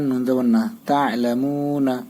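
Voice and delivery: normal talking voice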